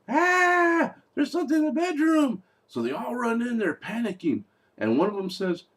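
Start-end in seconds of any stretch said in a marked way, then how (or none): 0.1-2.4 high shrieking imitation of a scared girl; mock scream. A girl running from the bedroom, mock screaming. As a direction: shriek it like a horror movie girl. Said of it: "she runs out screaming".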